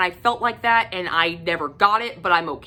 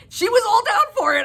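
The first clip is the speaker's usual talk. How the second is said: high-pitched laughing, almost crying; laughing fit; 3 dB louder than usual. Real, not acted. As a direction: laugh until the voice cracks. Laughing at the shocking scene.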